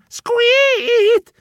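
high mouse squeak; frightened squeak. A narrator's reading. Terrified mouse letting out a squeak.

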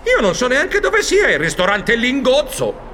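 high squeak then baffled protest; very high mouse squeak, then high confused denial; dumbfounded squeak. Acted. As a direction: squeak in pure shock. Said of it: "lo guardavo allibito".

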